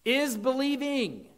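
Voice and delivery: "is believing" pitched high and leaned on; stress on is believing. Shown as a demonstration. Preacher retranslating the verse, stressed. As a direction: hit "is believing" like a correction. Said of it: "would be translated in today's English".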